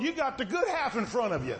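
ordinary delivery

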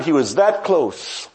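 ordinary talk

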